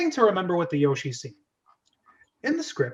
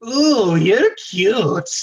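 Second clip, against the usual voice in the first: slow, loud, high crone purr; seductive hag; 5 semitones higher, 8 dB louder, much slower than usual. Acted. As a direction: drag it out like a lusty old hag. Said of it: "Smiles seductively".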